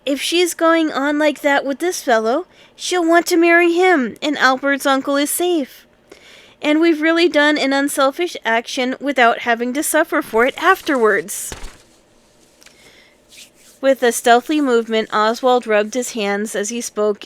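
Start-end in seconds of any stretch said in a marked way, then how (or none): 0.0-11.5 put-on higher, louder excited boy; a boy thrilled by his own idea; lightly voiced boy leader, excited; eager boy. A narrator's reading. Oswald explains eagerly.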